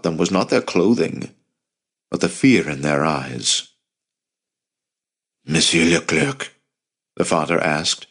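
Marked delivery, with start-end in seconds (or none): 5.5-6.5 tired and gravelly. A narrator's reading. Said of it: "his voice tired and gravelly".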